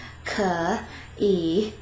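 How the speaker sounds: 可 and 以 split by a pause, each a full dipping tone; slow, separated 可以 with both tones complete; effortful, tone by tone. Shown as a demonstration. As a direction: labour through 可以 one third tone at a time. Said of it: "requires this extra effort to pronounce".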